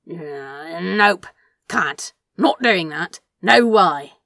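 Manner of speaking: blunt loud refusal. Acted. Crowley flatly refuses.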